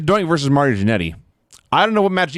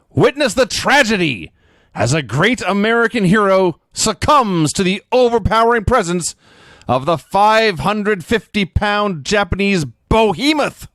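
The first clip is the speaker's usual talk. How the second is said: slow, booming announcer voice, pitched up; hammed-up hype announcer, dragging each word; Vince McMahon shouting; 6 semitones higher, slower than usual. Recapping the pay-per-view for his co-host, mock announcer boom. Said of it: "Vince McMahon shouts at me".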